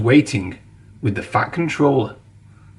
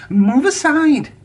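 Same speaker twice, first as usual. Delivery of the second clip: high, slow, pushy order; a high, slow command from a proud little engine; bossy little engine; 13 semitones higher, slower than usual. A narrator's reading. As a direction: puff yourself up and push past. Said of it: "said Thomas".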